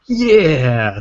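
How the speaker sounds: loud, high cheer; excited shout. Real, not acted. Cheering after show plug.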